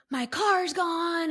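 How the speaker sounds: high, drawn-out wail down the phone; mock-distraught, stretching the words high; retold panic. Daughter phoning mom about the vanished car, panicked wail.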